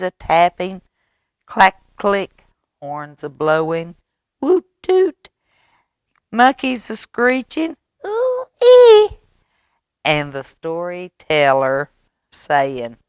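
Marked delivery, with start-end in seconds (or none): 4.4-5.3 high loud horn toots; party horns. A narrator's reading. Party horn sound effect. 8.0-9.2 very high loud monkey squeals; monkey screech. A narrator's reading.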